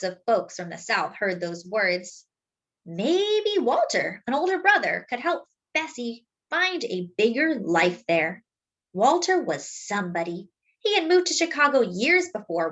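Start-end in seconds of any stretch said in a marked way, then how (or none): none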